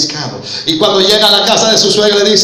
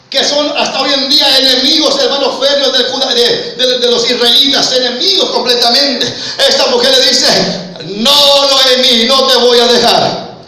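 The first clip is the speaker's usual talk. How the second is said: raised, higher preaching voice; fervent preaching; 6 semitones higher, 3 dB louder than usual. Acted. Preacher retelling Ruth's vow to the church, fervent preaching. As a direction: preach it out to the congregation.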